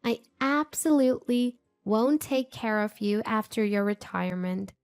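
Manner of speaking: high, soft mock-sweet girl voice; taunting daughter. A narrator's reading. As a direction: dismiss her sweetly and cruelly.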